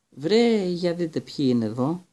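loud, high sneering drawl; mock the boys from the doorway; drawling Malfoy. A narrator's reading. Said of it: "με τη γνωστή αργόσυστη, ηρωνική φωνή του". (σαρκαστικός, ψηλός και δυνατός Μαλφόι)

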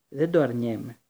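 plain reading voice

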